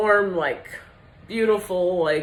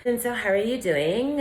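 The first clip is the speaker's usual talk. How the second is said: put-on old woman's affected high greeting; put-on high feminine voice; 7 semitones higher than usual. Imitating an old woman greeting someone, affected high voice. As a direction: put on a higher, sing-song polite voice. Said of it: "Have you ever met an old woman and she's like".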